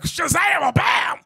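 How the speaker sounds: loud high Shazam, bam; mock reveal. Pastor acts out the makeover reveal, loud and high. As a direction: pop it like a magic trick.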